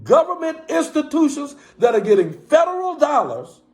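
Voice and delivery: high, rising shouted appeal, building in force; impassioned preaching. Real, not acted. Live streamer calling on viewers to act, fiery preaching shout. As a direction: preach it hard at the congregation of viewers, calling them out.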